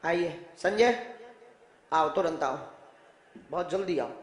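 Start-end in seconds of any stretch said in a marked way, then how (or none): none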